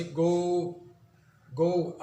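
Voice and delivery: गो with a drawn-out ओ; लंबी ओ के साथ गो; long O in go. Shown as a demonstration. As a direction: stretch the ओ in गो. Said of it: "थोड़ी अपनी आवाज़ को लंबा करेगा".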